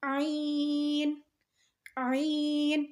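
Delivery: عین squeezed from mid-throat, very drawn out; mid-throat sound. Shown as a demonstration. Teacher modelling for learners, عین from mid-throat. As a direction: press the throat and stretch عین. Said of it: "حلق کے بیچ والے حصے سے".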